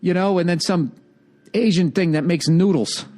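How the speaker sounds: ordinary talk, nothing marked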